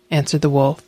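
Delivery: usual speaking voice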